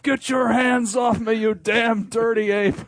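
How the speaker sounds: slow, high movie snarl. Retold or imitated. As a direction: growl the famous ape line. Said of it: "Could you say it to yourself?".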